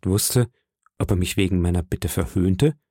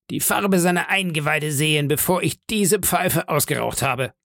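defiant challenge, pitch raised; Silver; 7 semitones higher than usual. Acted. Pirate leader dares the crew to fight, defiant. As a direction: dare them in a higher, goading voice.

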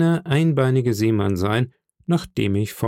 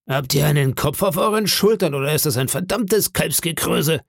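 higher-pitched scolding, moderately raised; exasperated call; 5 semitones higher than usual. Acted. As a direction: berate the dim sailor. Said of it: "rief Long John".

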